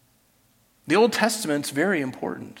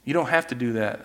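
gentle God voice; 4 semitones lower, 8 dB quieter than usual. Retold or imitated. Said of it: "God's going".

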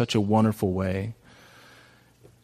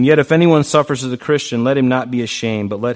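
louder proclaimed verse; loud scripture reading; 7 dB louder than usual. Acted. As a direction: announce and declaim the verse.

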